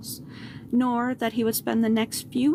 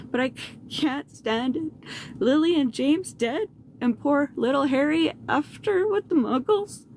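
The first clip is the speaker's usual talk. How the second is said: higher, tearful giant's voice; tearful Hagrid; 5 semitones higher than usual. A narrator's reading. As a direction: choke the words out as he breaks down. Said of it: "sobbed Hagrid".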